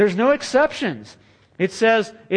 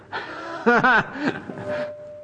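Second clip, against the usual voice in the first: amused laughter; 9 semitones higher than usual. Real, not acted. Laugh after joke.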